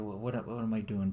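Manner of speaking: ordinary delivery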